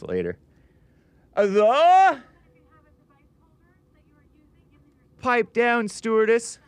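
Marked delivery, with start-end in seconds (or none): 1.4-6.6 big high announcer shout; show announcer call. Acted. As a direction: announce the show title loudly. Host announces show title.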